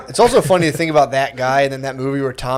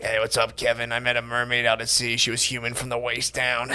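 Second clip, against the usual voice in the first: lightly voiced old Navy uncle; 4 semitones lower, 11 dB quieter than usual. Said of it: "he always talked like".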